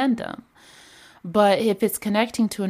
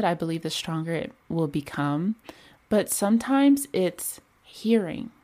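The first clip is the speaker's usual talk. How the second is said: ordinary talk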